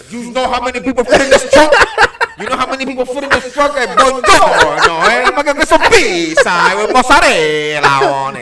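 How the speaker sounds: loud, hammed-up Italian-American wiseguy; boisterous mock Italian-American; movie Italian-American accent. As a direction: overplay the Italian-American accent through laughter. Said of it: "the accent like they do in the movies".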